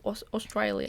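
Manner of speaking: soft attempt at Australian; imitated Australian accent. Retelling last night's stand-up, attempted Australian accent. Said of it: "they said something last night at stand-up".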